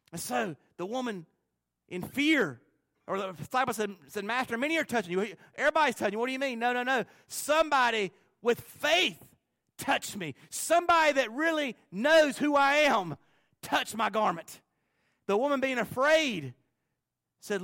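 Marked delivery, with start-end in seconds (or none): 4.2-14.6 raised and louder, insistent Master voice; Jesus insisting someone touched him, higher and louder; dramatic Jesus voice. Acted. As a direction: proclaim it like Jesus correcting his disciples. Preacher voicing Jesus and disciple.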